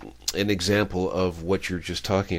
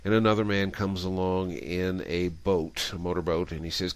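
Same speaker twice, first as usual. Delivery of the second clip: usual speaking voice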